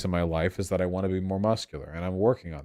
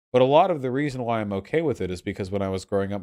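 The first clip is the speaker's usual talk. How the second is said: usual speaking voice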